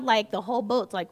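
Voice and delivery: ordinary talk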